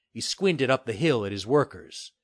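ordinary delivery, nothing marked

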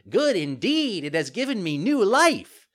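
put-on high, loud joyful puppet child; elated. A narrator's reading. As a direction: exclaim with childish delight. Cured puppet rejoices.

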